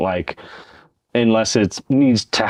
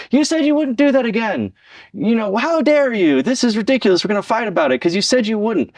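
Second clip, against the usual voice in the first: high, pitched-up accusing yell at a partner; hammed-up outrage, much higher; mock angry yell; 11 semitones higher than usual. Scolding a partner who slipped up, high mock yell. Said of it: "We're not gonna yell at them".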